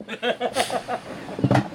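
high, soft breathy laugh; real laugh at a crude joke; laughter. Real, not acted.

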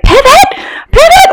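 high, frantic shout of Pivot; frantic shouted Pivot, rising each time; Ross shouting pivot. Retold or imitated. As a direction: yell Pivot like a panicked sitcom guy moving a couch. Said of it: "total friend style when he's like".

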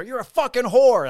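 high, very loud shouted insult; retold furious yell. Officer yelling back at the dog owner, retold furious shout. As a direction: imitate him yelling at full force. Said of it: "Frank's yelling at her".

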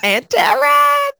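high, loud drawn-out name call; shouted intro. Real, not acted. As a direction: shout your name like a host.

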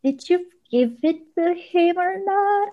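high, slow, worried asking; anxious asking. Retold or imitated. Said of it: "So I was, was like".

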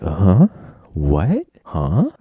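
loud, stunned what; shocked confusion. Retold or imitated. Student learning about a surprise midterm, loud stunned confusion. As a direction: blurt out in disbelief. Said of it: "I'd be like".

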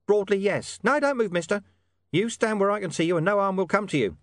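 high-pitched voice from the shadows; mystery informant. Acted. Hidden informant ordering William to keep still, high and wary. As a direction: warn him off, pitched well up.

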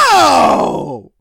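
long, loud, drawn-out no; big stretched cry of refusal; mock outraged shout. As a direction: holler it out in comic horror. Visitor rejects the candle.

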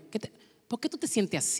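very soft probing question; soothing therapist. Acted. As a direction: play a therapist asking gently. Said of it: "me convierto en un terapista". (terapista que pregunta muy suave)

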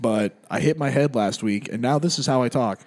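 low, subdued normal-guy voice; imagined accentless confession; Shahid without accent. Pretend. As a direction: explain it plainly in a dropped voice. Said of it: "talks like a normal fucking guy".

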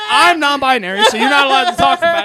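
loud mock indignation; mock outrage. Mock outrage about non-binary.

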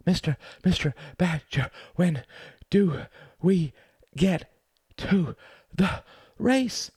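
high, out-of-breath mouse voice; panting. A narrator's reading. Exhausted Mouse running beside Badger, high panting plea. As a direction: gasp it out in broken bursts. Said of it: "he panted".

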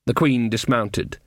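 plain reading voice

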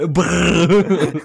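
dragon fire rumble. Pretend. Imitating fire breathing.

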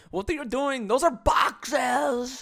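high, mocking fake Russian accent, dragged slow; terrible put-on Russian accent; bad Russian accent. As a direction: ham up a terrible Russian store clerk. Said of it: "my accent for Russian people was absolutely terrible".

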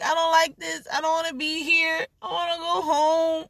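high, teary whine; mock sobbing, wanting to go home; high-pitched half-cry; whiny crying. Rocking in car crying.

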